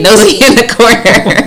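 laughing through the words, high and loud; teasing the friend through a laugh. Real, not acted. Teasing Kyla about being nosy, laughing.

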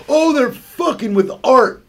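high, drawn-out mock outrage; high, slow imitated griping; mock complaint. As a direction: whine it slowly like an offended purist. Mimics people complaining about edited films.

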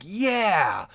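high, drawn-out cheer; imitated cheer. Imagined viewers greeting returning cast members, an excited cheer. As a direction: cheer it, swooping up high and back down.

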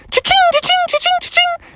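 rapid-fire squeaky cha-chings; very high strike noises in a string; repeated spear sound effects. Acted. Froggy jabs over and over, high sound effects.

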